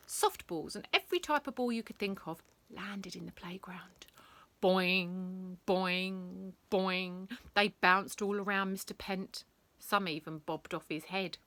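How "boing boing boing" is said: bouncy boing sounds. A narrator's reading. Bouncing ball sound effect.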